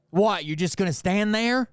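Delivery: retold cop's incredulous challenge; challenging cop. Cop to the speaker across the car, challenging.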